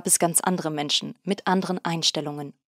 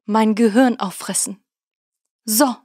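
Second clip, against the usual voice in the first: higher, louder outburst stressing 'Gehirn'; indignant; 4 semitones higher, 5 dB louder than usual. A narrator's reading. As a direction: blurt the worst fear in outrage. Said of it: "seine Empörung auszudrücken".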